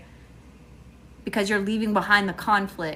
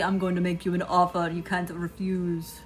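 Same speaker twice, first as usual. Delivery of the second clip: mobster-style offer; 3 semitones lower, 5 dB quieter than usual. Acted. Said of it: "They're gonna tell you".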